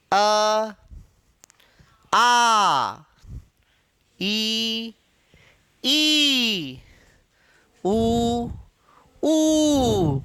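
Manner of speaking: sustained, separate vowels "अ, आ, ई, ई, उ, ऊ"; teacher sounding out pure vowels one by one; vowel demonstration. Shown as a demonstration. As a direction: hold each vowel out on its own, short then long. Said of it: "इनका उच्चारण करके देखते हैं".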